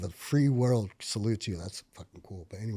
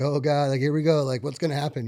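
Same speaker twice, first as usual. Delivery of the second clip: nervous trembling; 4 semitones higher, 8 dB louder than usual. Retold or imitated. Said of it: "I start trembling a little bit".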